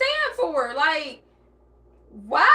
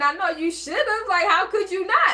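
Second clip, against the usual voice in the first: usual speaking voice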